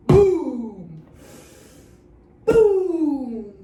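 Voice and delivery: loud booming thunder. Shown as a demonstration. Teacher leads kids in loud thunder booms. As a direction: drop a big boom with the arm. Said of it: "let's start with our thunder".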